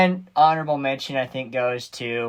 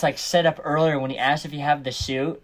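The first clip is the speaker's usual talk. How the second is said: ordinary talk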